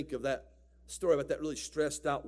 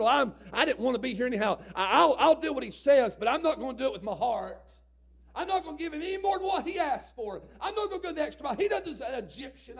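put-on a mild sulky teen, louder and higher; half-hearted sulk; 8 semitones higher, 5 dB louder than usual. Joseph as a sulky seventeen-year-old slave, grumbling. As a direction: grumble it like a resentful teenager. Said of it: "That's what he could have said".

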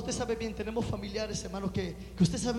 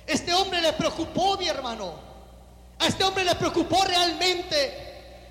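passionate preaching; 6 semitones higher, 6 dB louder than usual. Acted. Pastor contrasting easy blessings with real prayer, passionate preaching. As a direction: press each line home from the pulpit.

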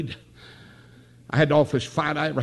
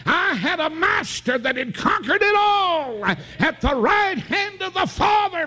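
preaching at full force; slower than usual. Acted. Preacher lifting his congregation with a full shout. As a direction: let it ring to the back pew.

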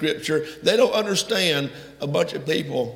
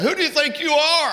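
rhetorical cry; 11 semitones higher, 3 dB louder than usual. Real, not acted. Preacher's rhetorical challenge.

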